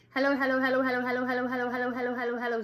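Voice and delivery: rapid loud chant; fast. A narrator's reading. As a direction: rattle them off as fast as possible. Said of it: "said Lori as fast as she could".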